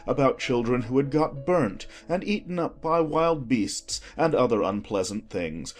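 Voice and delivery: plain reading voice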